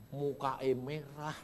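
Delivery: usual speaking voice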